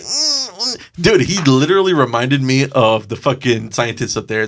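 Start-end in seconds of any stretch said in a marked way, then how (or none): 0.0-1.0 mock whimper. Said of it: "He was like".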